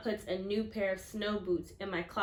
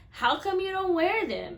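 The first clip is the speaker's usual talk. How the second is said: curious Antonio; 8 semitones higher, 5 dB louder than usual. Acted. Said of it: "Antonio says".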